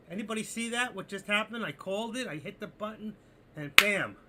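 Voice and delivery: high and loud, thrilled he called it; excited shout. Real, not acted. Trader to his chat after nailing the call, excited shout. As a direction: crow it out loud, real triumph.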